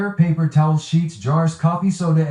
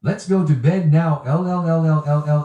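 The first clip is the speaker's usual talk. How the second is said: robot voice; much faster than usual. Pretend. Text-to-speech reads superchat.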